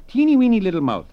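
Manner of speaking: high, loud, squeaky mock voice; mocking tiny voice. Mocking the president's mouth.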